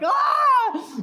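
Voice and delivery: very high, loud scream; hammed-up anguished shriek; imitated childhood wail. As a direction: wail like a kid being carried off. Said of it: "the vivid memories of going".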